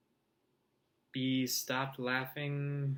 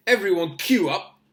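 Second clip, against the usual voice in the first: loud, high roar to a crowd; big mocking public announcement; 6 semitones higher, 9 dB louder than usual. A narrator's reading. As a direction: bellow it across a courtyard. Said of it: "Malfoy roared to the crowd".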